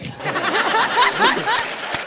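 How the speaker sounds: shrill mock witch cackle; high cackle.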